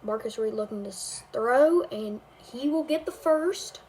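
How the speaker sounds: game commentator calling the drive; amateur play-by-play over a video game; live play-by-play. Acted. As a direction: call each snap like a broadcaster.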